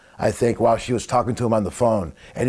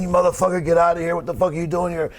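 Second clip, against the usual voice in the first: higher-pitched mock yell, cursing him out; retold angry yelling; 7 semitones higher than usual. Pillman cursing at Leon by the elevator, mock angry yell. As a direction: replay the blow-up, half acting it. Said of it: "Pillman started going off on Leon".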